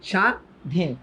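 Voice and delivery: সা, ধীন as two separate syllables; silent ব-ফলা. Shown as a demonstration. Teacher showing students what they normally say, split syllables. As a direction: say "sha", pause, "dhin", no w sound. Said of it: "তুমি normally বলতেছো সা".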